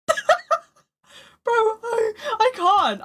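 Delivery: real laughter breaking through the words, voice jumping high; crying with laughter. Real, not acted. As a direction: laugh so hard the words barely come out. Can't stop laughing at story.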